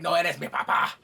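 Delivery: loud, punchy retort; mock defiance. Stepson rejecting Daemon as dad, loud mock defiance. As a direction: fire it off like a defiant kid.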